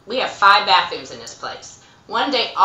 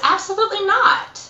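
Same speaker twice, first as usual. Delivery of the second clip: firm refusal; 12 semitones higher than usual. Retold or imitated. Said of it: "And I said".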